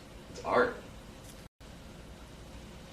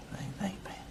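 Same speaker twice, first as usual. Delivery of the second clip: much softer breathy whisper; 7 semitones higher, 11 dB quieter, faster than usual. Real, not acted. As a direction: whisper the thanks. Thanks, then whispers it again.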